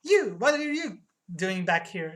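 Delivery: loud, drawn-out opening, then accusing; startled confrontation. Acted. Clerk to returning customer, startled and accusing. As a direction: lean hard on the first word.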